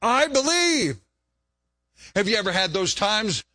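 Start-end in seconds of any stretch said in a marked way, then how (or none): 0.0-1.0 high, loud cry of faith; heartfelt prayer cry. Acted. Preacher crying out his belief to the Lord, raised and high. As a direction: call out to the Lord, pitched high.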